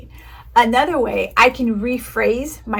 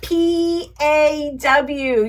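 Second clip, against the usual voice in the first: letters spelled very slowly; slow spelling; 5 semitones higher, much slower than usual. Shown as a demonstration. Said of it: "Everybody spell it".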